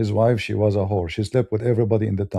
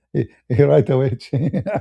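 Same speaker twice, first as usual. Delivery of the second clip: higher voice breaking into real laughter; amused laughing; 3 semitones higher, 3 dB louder than usual. Real, not acted. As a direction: crack up while telling it. Laughing at his own anecdote.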